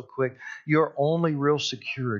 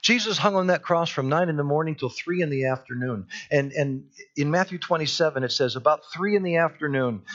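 ordinary talk, nothing marked

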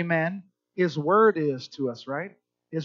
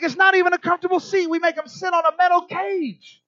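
indignant mock; 9 semitones higher, 4 dB louder than usual. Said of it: "he's like".

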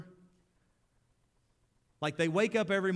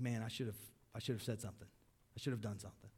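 quiet regret; 5 semitones lower, 9 dB quieter, much faster than usual. Retold or imitated. Inner regret voiced quietly.

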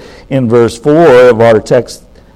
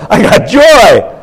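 excited; 9 semitones higher, 4 dB louder, slower than usual. Acted. Preacher leads into the song.

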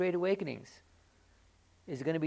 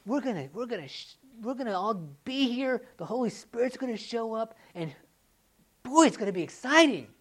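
raised, loud and thrilled about tonight; excited faith; 8 semitones higher, 8 dB louder than usual. Retold or imitated. Believer telling the church the Spirit will show up, rising excitement. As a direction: build to a loud burst on exciting. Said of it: "the faith to say".

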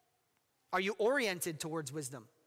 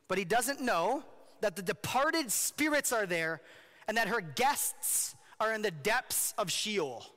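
usual speaking voice

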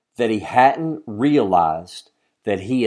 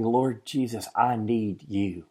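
hushed prayer; 3 semitones lower, 9 dB quieter than usual. Acted. Voicing a desperate whispered-soft prayer.

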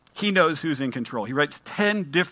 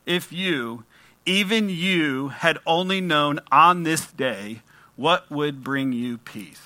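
very slow, every word "even" weighed; slow, tender plea; slower than usual. Shown as a demonstration. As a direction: say it slowly, as Jesus pleading with you. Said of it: "imagine Jesus is saying this to you".

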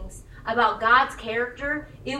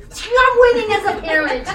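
laughing mock triumph; 7 semitones higher, 9 dB louder than usual.

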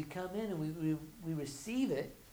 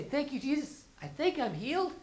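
much higher, forced cheerful thanks; hopeful mock praise; 9 semitones higher, 4 dB louder than usual. Churchgoer doubting on Tuesday, forced high praise. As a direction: talk yourself into it.

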